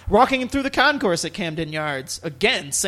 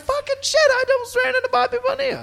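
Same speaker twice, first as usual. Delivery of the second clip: excited; faster than usual. Retold or imitated. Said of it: "And I'm like".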